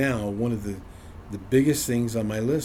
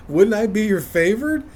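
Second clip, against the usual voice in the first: mock upset complaint; 8 semitones higher, 7 dB louder than usual. Upset with God over the neighbors' power, louder mock complaint. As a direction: grumble it out loud, half joking. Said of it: "I was getting upset".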